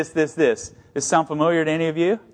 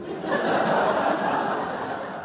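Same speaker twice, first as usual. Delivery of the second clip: breathless laugh; 7 dB quieter than usual. Real, not acted. Laughing at Goochland.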